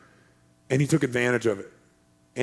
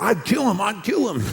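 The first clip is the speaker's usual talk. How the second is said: higher-pitched angry threat; hammed-up vengeful outburst; mock fury; 6 semitones higher than usual. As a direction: imitate an outraged friend. Other buddy acted as furious.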